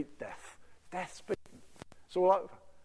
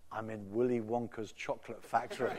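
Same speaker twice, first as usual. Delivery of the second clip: laughing; 5 semitones lower, 8 dB quieter than usual. Retold or imitated. Laughing into phone to son.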